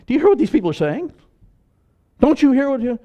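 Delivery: high, loud, fast indignant challenge; indignant priests. Acted. Chief priests challenging Jesus, high, fast and indignant. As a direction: demand it like offended priests.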